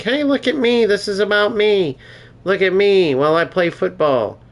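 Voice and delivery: high, hammed-up look-at-me voice; mock show-off streamer. Mocking streamers who show their face, in a high, hammed-up voice. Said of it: "putting myself up on the screen like".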